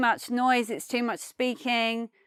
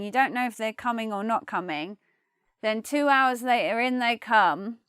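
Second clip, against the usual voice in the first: ordinary talk, nothing marked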